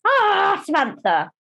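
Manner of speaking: high, drawn-out "Ah" dropping onto the name; hammed-up high exclamation, then settling; showy public persona. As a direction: act out a big, showy entrance. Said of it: "all they see is this".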